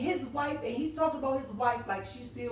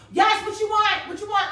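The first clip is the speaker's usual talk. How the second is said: louder and higher, impatient snap at the caller; mock irritated answer; 8 semitones higher, 8 dB louder than usual. Wife who doesn't care, answering husband's call, mock irritated and loud. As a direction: brush off your spouse like a nuisance. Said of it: "instead of saying".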